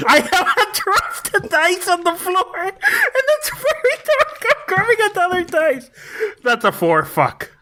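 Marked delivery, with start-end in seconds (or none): 0.1-5.9 giggling through it, much higher; laughing. Real, not acted. Player cracking up retelling his dropped dice, laughing. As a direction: laugh out the dice mishap, pitched way up. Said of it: "repeats it laughing".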